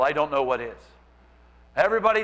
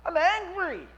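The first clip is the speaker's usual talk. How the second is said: higher, put-on petulant complaint; mock angry David; 10 semitones higher, slower than usual. David complaining to God, mock angry. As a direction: whine it up at God. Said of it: "he's saying".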